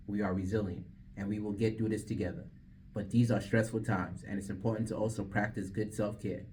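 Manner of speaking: earnest public service read; PSA read. Real, not acted. DJ reading a public service announcement on air, earnest voice. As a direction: read it sincerely to listeners.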